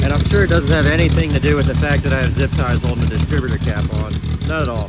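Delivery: dry, deadpan sarcasm; sarcastic. Real, not acted. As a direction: say it like you obviously mean the opposite. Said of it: "I'm being completely sarcastic".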